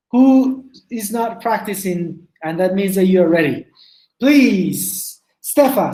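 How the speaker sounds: ordinary talk, nothing marked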